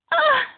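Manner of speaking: very high, loud squeal of joy; excited squeal. A narrator's reading. Friend jumping up and down clapping, excited squeal. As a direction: squeal with delight.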